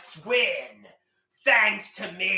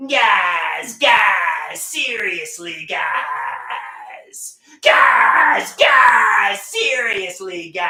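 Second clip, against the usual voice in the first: yelling guys louder each time; shouting; 8 dB louder, much slower than usual. Acted. Yelling at his friends to get their attention. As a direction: bellow it across the room.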